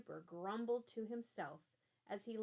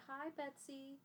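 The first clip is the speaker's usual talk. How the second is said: imitating sweet hello; 6 semitones higher than usual. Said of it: "the real nice way you say".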